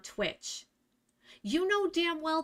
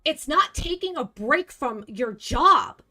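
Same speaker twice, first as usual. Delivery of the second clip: louder and higher, furious scolding; angry yelling; 4 semitones higher, 8 dB louder than usual. Real, not acted. Streamer yells at a viewer who games at work, furious.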